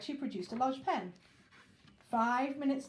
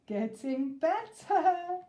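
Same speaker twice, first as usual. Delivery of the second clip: chuckling; 6 semitones higher, 6 dB louder, much slower than usual. Acted. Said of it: "she chuckled".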